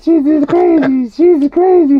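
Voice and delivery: high, loud, drawn-out singsong; playful high taunt, stretched out; sing-song teasing. Real, not acted. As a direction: tease the dog in a slow, high chant.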